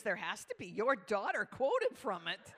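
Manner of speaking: imitated leader protesting loudly; loud insistence. Leader insists to the pastor about his daughter's quote, emphatic. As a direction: land it as an emphatic, loud punchline.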